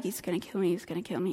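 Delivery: terrified son muttering doom; scared mumble. Acted. Son frozen in dread.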